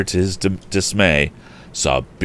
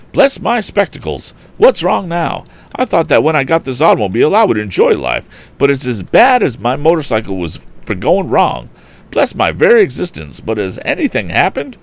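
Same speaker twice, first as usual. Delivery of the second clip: put-on high-pitched, fussy eccentric old gentleman exclaiming; excitable Mr Damon; 8 semitones higher, 4 dB louder than usual. A narrator's reading. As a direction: fret loudly over the broken car, voice pitched up. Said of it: "The voice exclaimed".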